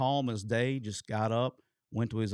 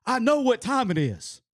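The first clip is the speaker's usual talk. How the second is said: loud, much higher imitation of the head coach; impatient boss; 13 semitones higher, 8 dB louder, slower than usual. Head coach turning to the staff, loud high mock retort. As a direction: bark it out like the boss who noticed the clock. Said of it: "and he turns, he goes".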